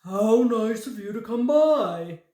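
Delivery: loud, delighted exclamation; exclaiming. A narrator's reading. Fat man welcomes the visitors, loud and delighted. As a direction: burst out in welcome. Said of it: "exclaimed a man".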